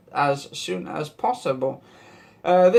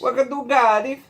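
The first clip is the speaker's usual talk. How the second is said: put-on higher-pitched, playful Welsh accent; mock Welsh welcome, pitched up; 6 semitones higher than usual. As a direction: put on a Valleys Welsh lilt. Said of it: "that's why I like the accents".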